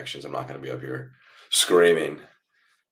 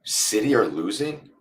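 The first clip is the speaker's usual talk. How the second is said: slow high surprised query; surprised question; 6 semitones higher, slower than usual. Real, not acted. Streamer reading chat news of City losing, surprised. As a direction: react amazed that City are losing. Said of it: "reading chat".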